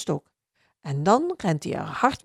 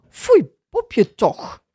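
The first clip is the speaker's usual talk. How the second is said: higher, louder, slow stern scolding; 6 semitones higher, 8 dB louder, slower than usual. Acted. The little owner scolds Bob for digging, stern slow scolding. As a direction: scold the dog slowly and firmly. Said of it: "zegt het bazinnetje streng".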